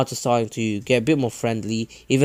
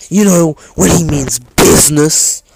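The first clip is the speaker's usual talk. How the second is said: a lightly put-on posh voice, louder; 3 semitones higher, 10 dB louder than usual. Jiraiya showing off his posh business voice. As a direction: announce it grandly, like a posh man. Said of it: "I will keep the posh voice".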